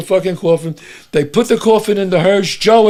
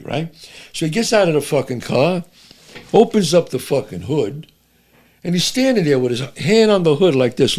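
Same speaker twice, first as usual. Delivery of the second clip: ordinary talk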